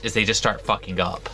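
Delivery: ordinary delivery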